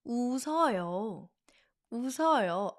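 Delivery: "웃어요" drawn together as u-seo-yo; "웃어요" with the s carried over; smooth, linked. Shown as a demonstration. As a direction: glide through "웃어요" with no stop.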